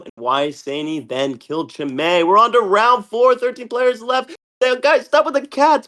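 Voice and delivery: ordinary talk, nothing marked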